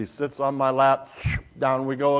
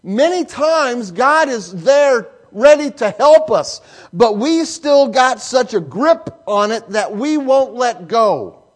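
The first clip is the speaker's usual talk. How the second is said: rising, louder preacher's cadence; preaching; 5 semitones higher, 3 dB louder than usual. Acted. Pastor presses the lesson on his congregation, fervent preaching. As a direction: drive the point home to the congregation.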